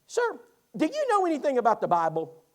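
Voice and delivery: high, loud imitated woman's question; woman at the door. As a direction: act out a pointed question from the lady of the house.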